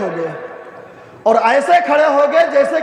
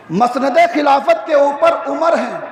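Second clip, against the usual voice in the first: ordinary talk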